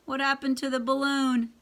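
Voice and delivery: usual speaking voice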